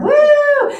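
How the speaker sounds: a high, loud celebratory woo; excited whoop. Acted. Trainer cheering about Friday happy hour, high loud whoop.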